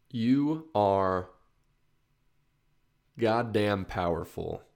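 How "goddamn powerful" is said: normal talking voice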